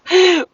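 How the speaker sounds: big high laugh bursting out; loud burst of laughter. Real, not acted. Laughing at the dog's serious face, loud real laugh. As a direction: crack up loudly.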